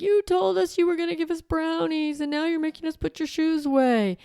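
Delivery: put-on high child's voice protesting; crying child complaint. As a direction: whine it like a kid who feels cheated. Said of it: "And they're crying and they're like".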